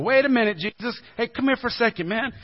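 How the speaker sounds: high and loud, building to a shout; uneasy Peter. Retold or imitated. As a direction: Peter pulling Jesus aside, rising panic. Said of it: "Peter gets a little uncomfortable".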